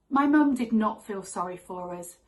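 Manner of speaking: normal talking voice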